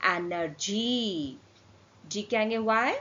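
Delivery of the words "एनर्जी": slow, lower "एनर्जी", g said as j; soft G word. Shown as a demonstration. Teacher reading G before Y as j, slow demonstration. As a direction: draw out "एनर्जी", long on the last syllable. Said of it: "तो क्या हो जाएगा".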